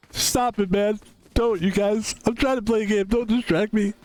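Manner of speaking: play-acted high complaint; mock pleading. Mock pleading with imagined fans.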